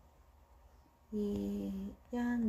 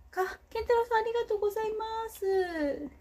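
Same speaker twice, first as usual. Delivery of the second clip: excited thanks; 10 semitones higher than usual. Real, not acted. Thanking a viewer for coins.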